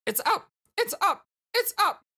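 high squeal, rising in pitch; excited game character. Acted. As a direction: squeak it in frantic bursts.

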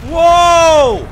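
huge high whoa; loud excited whoa. Real, not acted. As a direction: yell it out in awe. Big moment in game.

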